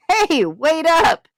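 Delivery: high, slow call after her; a brother calling after his sister, loud and high; yelled. Acted. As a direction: shout "wait up" across the lawn. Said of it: "Ethan yelled".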